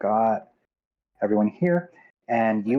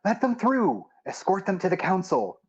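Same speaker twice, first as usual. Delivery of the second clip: higher-pitched captain's order; calling out; 9 semitones higher than usual. Acted. Captain orders guards to let the party through, calling out. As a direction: call a command over to the guards. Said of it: "the captain calls".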